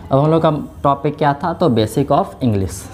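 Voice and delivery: ordinary talk, nothing marked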